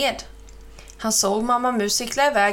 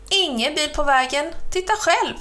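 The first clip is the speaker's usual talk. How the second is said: high, cheerful cow voice; light and sing-song reassurance; 6 semitones higher than usual. Acted. Mamma Mu reassuring the crow, in her high cow voice.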